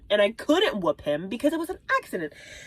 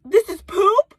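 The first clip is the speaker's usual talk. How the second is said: high, loud incredulous outburst; freaking out; 11 semitones higher, 6 dB louder than usual. Retold or imitated. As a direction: pitch shoots up in loud disbelief. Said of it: "when I'm freaking out".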